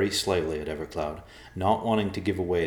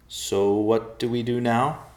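slow, high, nervous question; anxious and louder, pitched up; unsettled; 6 semitones higher, 5 dB louder, slower than usual. A narrator's reading. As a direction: ask uneasily, unsure of the next move. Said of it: "obviously unsettled by what was happening".

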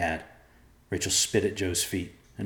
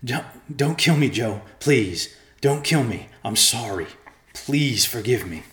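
breathless, pleading for mercy; begging for life; 4 semitones higher, 5 dB louder than usual. A narrator's reading. Murray pleads with Joe not to kill him, begging. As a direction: beg urgently, stressing the pleas. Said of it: "rasping and begging for his life".

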